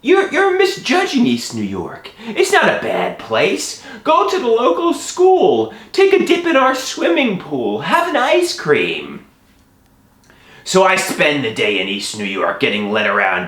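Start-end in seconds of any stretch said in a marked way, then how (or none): 0.0-9.2 put-on high, swooping old-lady voice; elderly woman. Elderly woman defending her neighborhood, high lilting voice. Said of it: "she tells me".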